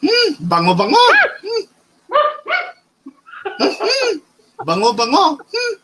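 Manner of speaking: high, far-off auntie sniff-kiss; pushy matchmaker auntie. Retold or imitated. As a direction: sniff and coo like a doting auntie. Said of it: "You gotta do the sniff too".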